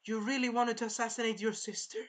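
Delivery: imitating a stunned father; shocked king. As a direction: ask it in mock royal disbelief. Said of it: "He was, like, shocked".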